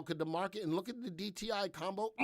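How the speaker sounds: normal talking voice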